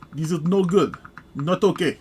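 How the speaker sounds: a hint of a joking Russian accent; mock Russian accent. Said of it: "That's how I say it in Russian".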